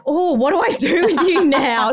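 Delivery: high, loud and lost; clueless what-now voice; bewildered mum. Retold or imitated. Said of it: "I get a little bit like".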